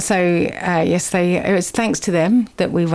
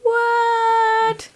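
mock surprise; 14 semitones higher than usual. Host feigns shock at show ending.

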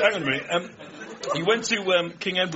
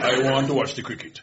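mock West Indian patois; put-on Caribbean father voice; dad's West Indian accent; 3 semitones lower than usual. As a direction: imitate a West Indian dad wanting his telly. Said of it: "In that accent".

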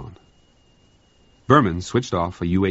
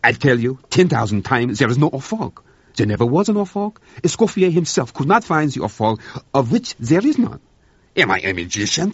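raised, higher exasperated French cook; exasperated rant; 7 semitones higher, 4 dB louder than usual. Acted. Cook to officer, fed up, raised exasperated voice. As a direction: insist there is no Orfog, getting worked up. Cook rants against the inventory.

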